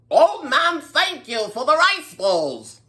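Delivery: a lightly voiced old mouse, high and slow; old mouse leader. A hint of the old mouse thanking the old man. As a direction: thank him like a gracious little elder. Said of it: "the old mouse said".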